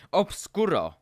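high, loud incantation; roared spell. A narrator's reading. Harry blindfolds Draco by magic, roaring. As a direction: roar the spell at your foe. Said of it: "zařval Harry".

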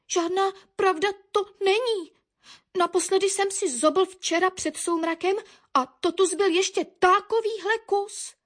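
lightly voiced high-pitched woodpecker; indignant denial. A narrator's reading. Woodpecker defends himself to Baron, high indignant voice.

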